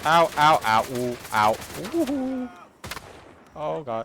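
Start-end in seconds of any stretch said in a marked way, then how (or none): 0.0-2.5 sharp yelps of pain. Real, not acted. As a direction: cry out as you get hit. Getting hit in combat.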